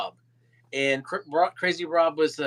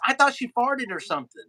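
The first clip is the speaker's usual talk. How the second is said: high imitated Irish accent, bewildered; 7 semitones higher, faster than usual. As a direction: play the bewildered Irishman, pitched up. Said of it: "[name] was in his Irish accent".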